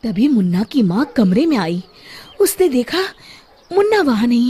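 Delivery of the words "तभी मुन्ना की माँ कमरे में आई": usual speaking voice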